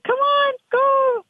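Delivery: high-pitched urging at the rail; excited cheering. Retold or imitated. Trainer reliving the race finish, urging excitedly. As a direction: cry out high to drive the horse on. Cheering her horse on, retold.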